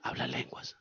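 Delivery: very soft whisper; whispered instruction. Shown as a demonstration. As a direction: drop to a hushed, voiceless aside. Instructs to pray in tongues quietly.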